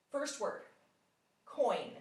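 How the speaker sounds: put-on teacher says coin first for the child to copy; modeling the word. Shown as a demonstration. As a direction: model coin for the student to echo. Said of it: "I'll say it first".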